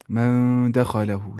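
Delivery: slow "من" held nasal, then even "دا خا لا ہو"; nasal ikhfa. Shown as a demonstration. Teacher shows how to read it, nasal ikhfa. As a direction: hum the noon of "من" before "دا خا لا ہو". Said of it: "ایسے پڑھنا ہے".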